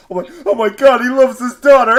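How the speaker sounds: high, loud, hammed-up blubbering; exaggerated weepy wail breaking into laughter; mock tearful outburst. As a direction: imitate bawling at a sad film, half laughing. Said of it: "I teared up".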